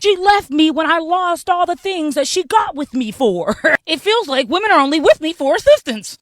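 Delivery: loud, high, hammed-up wailing; mock crying. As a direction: bawl like a self-pitying man, mockingly. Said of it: "they cry to us".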